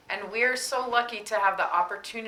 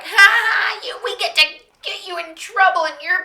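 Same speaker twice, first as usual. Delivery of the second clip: mocking gleeful voice; 10 semitones higher, 14 dB louder than usual.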